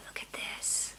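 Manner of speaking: hushed awed whisper; admiring the skein in a whisper. Real, not acted. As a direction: breathe it out in reverent hush. Admiring yarn up close.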